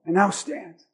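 soft, low quiet promise; steady comfort to the listener; lightly voiced gentle Jesus; reassuring Jesus. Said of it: "He says".

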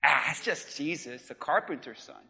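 low, soft dismissive aside; dismissive townspeople. Acted. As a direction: brush him off under your voice, pitched low. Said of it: "the people assumed".

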